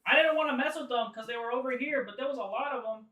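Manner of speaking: high, chummy little-monster voice; eager, friendly creature chatter; charmed creature. Acted.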